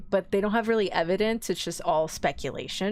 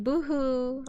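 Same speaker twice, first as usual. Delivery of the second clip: higher-pitched pretend weeping; mock boo-hoo; 4 semitones higher than usual. Accused woman plays the victim, mock boo-hoo. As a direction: toss off a fake little sob. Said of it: "She's like".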